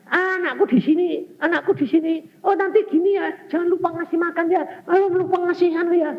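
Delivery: high, fussing mother voice; fussy mother. Retold or imitated. Overprotective mother fussing over her child, high and fussy. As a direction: chatter like an anxious mum. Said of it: "kemana-mana tanya".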